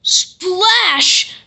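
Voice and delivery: splash called out high and loud; a bright, excited splash; big splash. A narrator's reading. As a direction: make the splash leap off the page.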